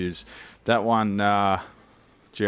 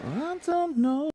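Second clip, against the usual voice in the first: silly high voice; much slower than usual. Acted.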